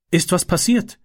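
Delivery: high, questioning exclamation; startled call. A narrator's reading. Mother sees Kate's tear-reddened face, calling out in surprise. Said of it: "rief sie".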